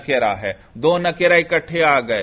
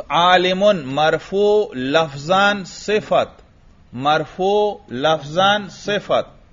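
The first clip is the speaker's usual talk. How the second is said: slow dictation; much slower than usual. Shown as a demonstration. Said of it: "آگے چلیے".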